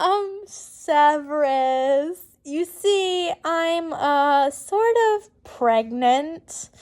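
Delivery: slow, halting admission; stumbling confession. Acted. Harry telling Severus the news, slow and hesitant. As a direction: drag it out, nervous and hesitant. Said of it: "I stumble a bit".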